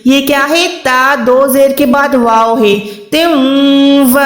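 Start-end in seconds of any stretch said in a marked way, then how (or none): 3.1-4.2 تم و with the tanween merged, nasal ghunna into و; تم و slow with a held nasal hum; nasal merging. Shown as a demonstration. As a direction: hum تم و through the nose. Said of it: "ادغام کرنا ہوتا ہے گننے والا".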